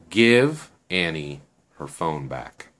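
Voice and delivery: slow, deliberate order, spaced word by word; stern demand. Acted. Shen confronts Natalia over Annie's phone, slow low demand.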